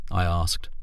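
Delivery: usual speaking voice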